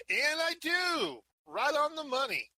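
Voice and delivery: drawn-out, high triumphant cry; triumphant shout. Acted. Mage boasting to the party about reading, triumphant. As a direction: crow it loudly, stretching the words. Mage celebrates being able to read.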